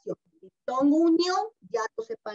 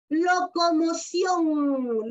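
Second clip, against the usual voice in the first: Locomoción spoken very slowly, syllable by syllable, lo-co-mo-ción; slow syllable demo; 3 semitones higher, much slower than usual. Shown as a demonstration. Teacher showing a diphthong to her children, very slowly by syllable. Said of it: "igual, miren".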